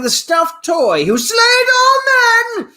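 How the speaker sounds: high, slow, roaring title announcement; hammed-up battle cry; epic mock shout. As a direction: bellow it like an epic trailer title. Reading out a title suggestion dramatically.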